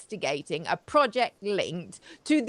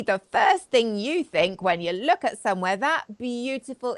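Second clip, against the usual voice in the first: normal talking voice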